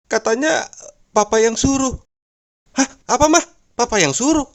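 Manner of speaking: high, put-on wife voice. Wife explains to her husband on the phone, naive.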